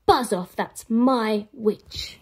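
loud, slow beast warning; monster growl. Acted. Beast warns the dragon away, loud and slow. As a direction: growl it as a threat.

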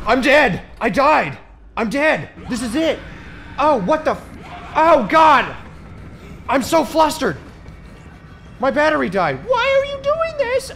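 high, loud, flustered yelling; loud panic. Real, not acted. Player sure they are about to die, panicked. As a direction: yell in short frantic bursts, ending in a high wail. Panicking while chased in game.

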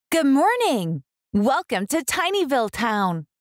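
put-on bright, friendly welcome to young listeners; cheery storytime greeting. Reader welcoming children to the story, cheerful. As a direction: greet the kids warmly at the start.

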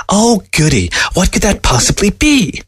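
high-pitched sarcastic glee; gushing fake eagerness; mock excitement. Fox feigns excitement at gift.

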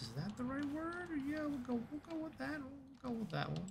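high, soft squeaky aside; tiny high-pitched voice, well under the breath; playful, small falsetto; silly little voice. Pretend.

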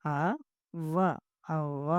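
అవ్వ in separate parts, then joined with held vv; syllables, pressed vv. Shown as a demonstration. Teacher builds the word for pupils, అవ్వ with pressed vv. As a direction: break up అవ్వ, pressing the double v. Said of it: "గట్టిగా ఒత్తి పలుకుతాం".

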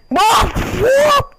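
high, loud startled whoa; startled yelp. Real, not acted. Gamer startled by a sudden enemy. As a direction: jump at something on screen.

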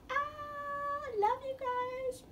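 bright high outro call; excited sign-off. Real, not acted. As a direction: sing out the goodbye to viewers. Loud vlog sign-off.

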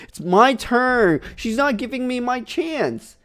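put-on high, slow kid whine over his turn; whiny child voice. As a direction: whine like an angry little kid. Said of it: "I was like".

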